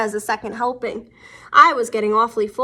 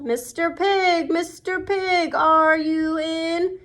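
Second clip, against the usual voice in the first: slow, higher sing-song call; 5 semitones higher, slower than usual. Acted. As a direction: call sweetly through the door. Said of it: "I called".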